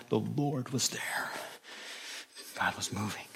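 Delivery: mock-reverent hush; hushed whisper. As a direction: whisper it like a holy moment. Awed church report of the night.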